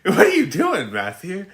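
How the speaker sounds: high, incredulous question; lightly mocking high-pitched disbelief; mock baffled onlooker. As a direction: play the stunned people staring at him. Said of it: "they'd be like".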